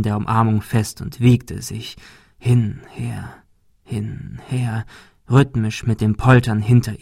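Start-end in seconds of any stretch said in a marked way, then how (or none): 2.4-4.8 near-whispered, spaced-out words; hushed rocking rhythm. A narrator's reading. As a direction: breathe each word out with the sway. Narrator mimics slow rocking. (leise geflüstert, im Wiegetakt)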